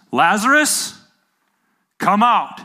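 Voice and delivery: high, loud calling out; loud cry. Retold or imitated. Jesus summoning dead Lazarus from the cave, crying out loudly. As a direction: call out commandingly, each part on its own. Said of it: "he cries out in a loud voice".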